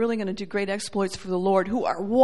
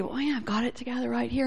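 quick, hushed show of having it together; imitating someone who seems all sorted; put-on composure; 10 dB quieter, faster than usual. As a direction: rattle it off softly, self-assured. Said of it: "Somebody that sounds really".

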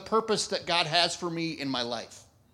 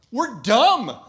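louder, laughing punchline; 4 semitones higher, 7 dB louder than usual. Real, not acted. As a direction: blurt it out with a laugh.

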